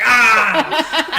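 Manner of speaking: sudden loud high "Ah!" through laughter; jump-scare yell. Retold or imitated. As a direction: leap out and yell to scare him. Lucas jumps out of closet.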